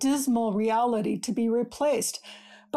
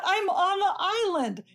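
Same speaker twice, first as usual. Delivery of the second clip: laughing in disbelief; giddy, disbelieving laugh; astonished laugh; 7 semitones higher than usual. Acted. Waking on the dream island.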